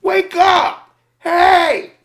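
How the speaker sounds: very high, loud yell at a sleeper; shouting wake up. Retold or imitated. Male cashier yelling at sleeping driver, loud high shout. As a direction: bellow to jolt the driver awake. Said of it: "really, they're like".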